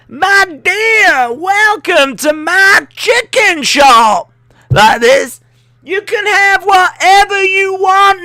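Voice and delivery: high, loud Southern drawl, hammed up; a grand old Southern gentleman welcoming a customer; Colonel Sanders southern accent. Wrestling fan imagining Leo as Colonel Sanders, hammy Southern accent. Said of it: "talk with, like, that southern accent".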